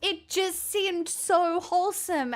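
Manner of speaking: high, drawn-out gushing. Real, not acted. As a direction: gush in a high voice, stretching the words. Gushing over the nun podcast.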